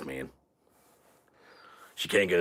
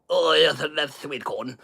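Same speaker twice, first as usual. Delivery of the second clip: mock British woman; 9 semitones higher, 3 dB louder, slower than usual. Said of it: "She's British, man".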